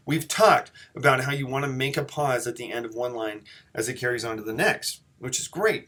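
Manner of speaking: ordinary talk, nothing marked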